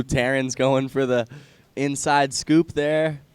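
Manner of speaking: normal talking voice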